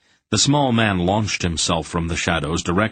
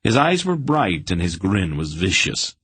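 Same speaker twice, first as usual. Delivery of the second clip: ordinary delivery, nothing marked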